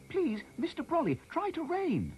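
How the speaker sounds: put-on high, loud child's call upward; boy shouting up to Mr Brolly; shouted plea. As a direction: beg the sky for rain at full voice. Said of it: "shouted Harry".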